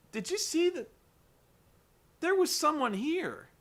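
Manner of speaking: high-pitched, incredulous mimicry; acted bewilderment. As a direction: play a rattled witness insisting on what she saw. Acting Angelina, startled at vanished visitor.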